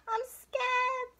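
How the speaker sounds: high small voice of a scared cub; high-pitched timid bear cub; little bear cub. A narrator's reading.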